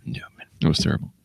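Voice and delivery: hushed, breathy aside; whispered. Real, not acted. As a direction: whisper it like a secret. Hushed comment after the lizard joke.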